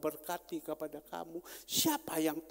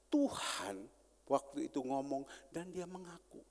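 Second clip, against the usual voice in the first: ordinary delivery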